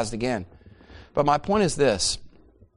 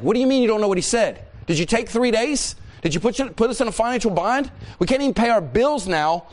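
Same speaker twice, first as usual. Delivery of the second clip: high, indignant questioning; exasperated wife; 7 semitones higher than usual. Acted. Wife challenging husband over lost wages, exasperated. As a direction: fire off incredulous accusations in a raised pitch.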